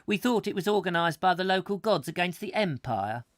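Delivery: high, loud centurion character voice; Roman centurion. Acted. Roman soldier marvels at the rain, loud character voice. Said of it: "he would ask".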